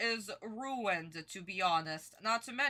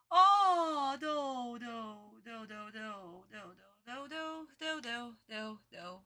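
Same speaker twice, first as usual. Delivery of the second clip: despairing groans; 4 semitones lower, slower than usual. Real, not acted. Reactor dreading the dub scene.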